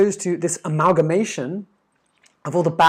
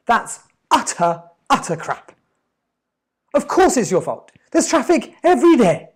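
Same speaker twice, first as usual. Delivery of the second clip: much higher and louder, calling out the excuse; exasperated outburst; 6 semitones higher, 5 dB louder than usual. Real, not acted. Speaker scolds listeners for blaming traffic, loud and exasperated. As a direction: blow up at the lame excuse.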